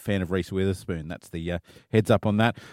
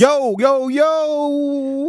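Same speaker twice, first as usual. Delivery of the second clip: mock American yo; much slower than usual. Co-host hyping the intro in a high mock voice. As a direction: hype it up like a DJ.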